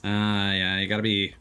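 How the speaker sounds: normal talking voice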